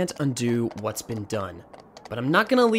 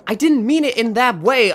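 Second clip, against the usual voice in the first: loud, pleading protest; urgent plea; 8 semitones higher, 8 dB louder than usual. Acted. Guard to the angry princess, urgent loud plea. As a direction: cry it out to stop her leaving.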